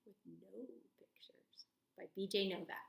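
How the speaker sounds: hushed, mysterious title reveal; dramatic whisper. Shown as a demonstration. Announcing the book title.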